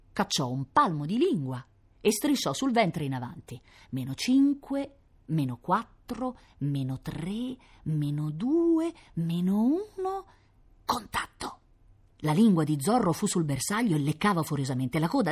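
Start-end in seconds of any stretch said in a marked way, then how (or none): 3.9-11.6 slow, drawn-out counting, hushed final word; suspenseful countdown. A narrator's reading. Dog creeping towards mysterious object, slow suspenseful countdown. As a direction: stretch each number out to build tension.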